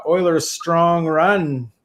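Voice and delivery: slow, drawn-out hype with a touch of sarcasm; mock-keen cheer, stretched out; sarcastic enthusiasm. Real, not acted. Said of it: "You, you say that with a lot of enthusiasm".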